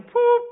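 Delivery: high sharp whistle toot; whistle blast. Retold or imitated. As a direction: mimic a lifeguard whistle. Lifeguard whistle imitated.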